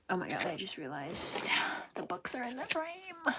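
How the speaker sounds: whispering to herself in surprise; hushed realization, under her breath; whispered oh-my-god aside. Real, not acted. Sudden realization, aside to self.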